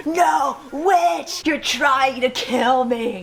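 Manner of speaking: high, slow mock accusation; hammed-up panic at a would-be rescuer; mocking paranoid drowner. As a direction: shrilly accuse the rescuer of witchcraft, dragging it out. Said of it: "you're like".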